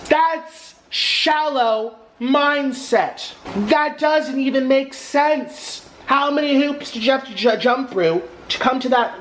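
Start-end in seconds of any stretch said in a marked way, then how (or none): 0.1-7.6 exasperated. Real, not acted. Exasperated rant.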